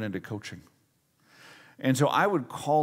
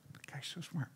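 very soft, muttered to himself; quiet awe; 3 semitones higher, 14 dB quieter than usual. Retold or imitated. Young minister marvelling at his coach after the call, hushed. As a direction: say it under your breath, humbled.

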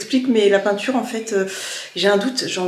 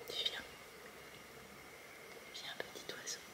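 whispering; 30 dB quieter than usual. Real, not acted. Coaxing a scared bird.

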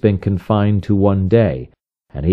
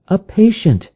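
lightly raised voice of the wife; a hint of the wife, gently prompting; wife announcing a patient, lightly voiced; wife's voice; 11 semitones higher than usual. A narrator's reading. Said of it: "said she".

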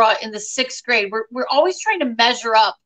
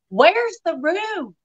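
puzzled Southern mother, stretching the words; Southern accent; 3 semitones higher than usual. Retold or imitated. Mother can't find the Zoom room, Southern accent. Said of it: "you had a Southern accent there".

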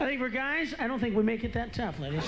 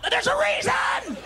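shout. Acted. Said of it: "comic snapping back at hecklers".

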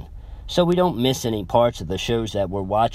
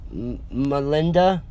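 slow, hesitant call with a stumble; cautious; 4 semitones higher than usual. A narrator's reading. Boyfriend nervously calling to his crying girlfriend, cautious. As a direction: tread carefully, a little unsure. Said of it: "cautiously said".

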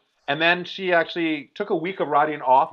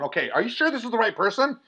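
higher, incredulous imitated questioning; double-check the name in disbelief; lightly acted baffled hospital staff; retold disbelieving medic; 8 semitones higher than usual. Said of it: "I heard them through the sheet going".

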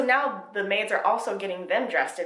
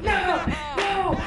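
much higher, louder frantic no's; mock panicked pleading; 11 semitones higher, 3 dB louder, faster than usual. Reader imitates the TikTok clip, mock panicked pleading. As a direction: beg like someone trapped behind a banging door. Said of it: "I feel exactly like that TikTok".

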